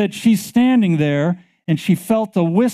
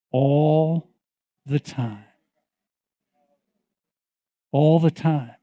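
intimate preaching; 2 semitones lower than usual. Acted. Preacher gently assuring the congregation, slow and hushed.

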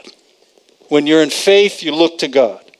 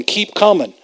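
ordinary delivery

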